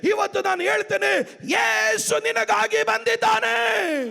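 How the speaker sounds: high, driving sermon shout; full-force preaching. Real, not acted. (ಪೂರ್ಣ ಶಕ್ತಿಯ ಉಚ್ಚ ಸ್ವರದ ಪ್ರವಚನ)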